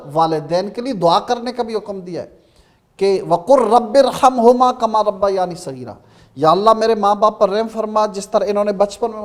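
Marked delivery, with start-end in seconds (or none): 3.3-5.9 Quranic recitation. Shown as a demonstration. Quran verse recited in talk.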